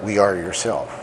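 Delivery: solemn poem recital. Acted. Lakota elder recites his poem to the world, solemn declamation. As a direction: proclaim each line like a chant to the people.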